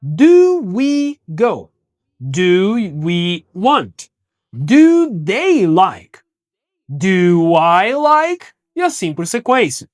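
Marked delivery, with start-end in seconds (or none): 0.0-8.5 slow, carefully spaced questions; slow teacher demo. Shown as a demonstration. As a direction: drill it slowly for the class. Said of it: "Eu posso alterar o subject".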